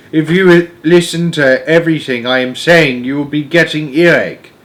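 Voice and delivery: lightly voiced giant putting someone off; BFG. The giant cautions curious Sophie, lightly voiced and emphatic.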